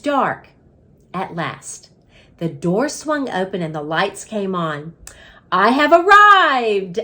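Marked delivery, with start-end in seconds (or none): 5.5-7.0 loud, slow, grand arrival; grand announcement. A narrator's reading. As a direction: announce yourself like a showman.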